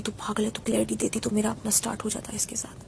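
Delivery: hushed whisper retelling a friend's words; secretive whisper passing on gossip; whispered quote. Retold or imitated.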